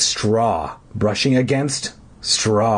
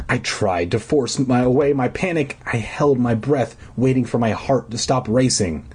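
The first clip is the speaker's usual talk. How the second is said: ordinary delivery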